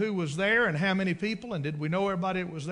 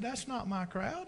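put-on old preacher's voice; 6 semitones higher, 5 dB quieter, slower than usual. Preacher voiced, high quiet.

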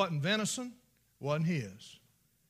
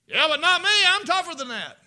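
loud, higher, cocky brag; mock tough boast; 11 semitones higher, 13 dB louder than usual. Preacher mimics the daredevil's loud cocky brag. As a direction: brag that nothing can hurt you.

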